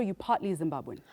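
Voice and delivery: ordinary talk, nothing marked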